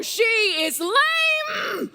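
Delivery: drawn-out, slow frustrated cry of lame; impatient shout. Acted. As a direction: stretch the word out in exasperation. Juliet impatient, nurse late.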